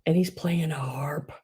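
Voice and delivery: soft unvoiced whisper. Real, not acted. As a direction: breathe it out in a hush. Cooing over a cute figurine.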